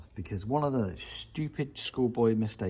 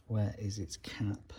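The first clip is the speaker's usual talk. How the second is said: slow whispered searching; whisper to self; 7 dB quieter, slower than usual. Real, not acted. Alone in the garage, searching for the cap, slow whisper. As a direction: whisper slowly while looking for the cap. Hunting for part, muttering.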